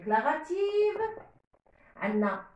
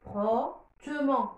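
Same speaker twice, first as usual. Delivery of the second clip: فروتمون said slowly and clearly; slow dictation; 3 semitones lower, slower than usual. Shown as a demonstration. Teacher to pupils, slow careful model word. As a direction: draw the word out for the class. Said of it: "لو راديكال".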